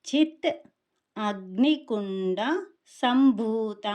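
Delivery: చిత్, అగ్నికుండ, సంభూత in three separate parts with pauses; word split. Shown as a demonstration. Teacher divides the name into three parts, separated and deliberate. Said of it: "మూడు భాగాలుగా విభజించవచ్చు".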